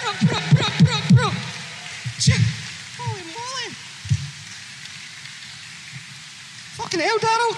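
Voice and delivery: high, stunned exclamations; amazed. Real, not acted. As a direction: blown away by the beat. Amazed by the beatbox.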